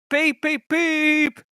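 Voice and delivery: high whistling peeps; whistle call. A narrator's reading. Henry calling out to the boys on the bridge, whistling. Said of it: "he called out".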